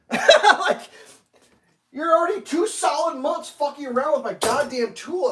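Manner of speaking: high, loud exasperated outburst; real fed-up shouting; furious rant. Real, not acted. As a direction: vent like you've had it. Ranting about customer service.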